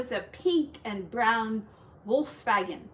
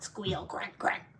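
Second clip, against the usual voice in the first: grunting pig imitation; pig noises; 3 semitones lower, 5 dB quieter than usual.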